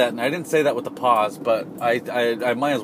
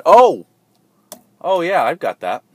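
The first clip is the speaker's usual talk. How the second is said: loud, high burst of understanding; oh, now I get it; a loud, high cry of sudden recognition; sudden realization; 8 semitones higher, 14 dB louder, slower than usual. Retold or imitated.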